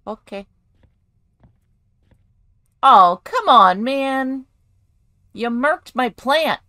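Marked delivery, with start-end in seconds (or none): 2.8-6.6 loud, drawn-out complaint; aggrieved, raised voice; exasperated protest. Acted. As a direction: protest loudly, stretching the words. Protests at damaged houseplant.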